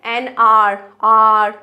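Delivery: आर drawn out as a long "aar", no h; slow teacherly demo of आर; sounding out word. Shown as a demonstration. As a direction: repeat आर with an open vowel start. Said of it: "आप सीधा word को बोलो".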